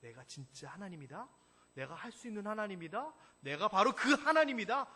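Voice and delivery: God insisting on who he is; emphatic declaration. Shown as a demonstration. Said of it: "강조하는 겁니다".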